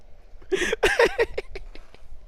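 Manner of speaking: quiet high giggling fit; real laughing fit. Real, not acted. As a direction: laugh helplessly while trying to go on. Cracks up trying to continue.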